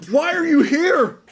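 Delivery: high, slow, baffled woman's voice; bewildered clerk. Retold or imitated. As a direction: play the stunned clerk, drawn out and high. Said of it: "she's like".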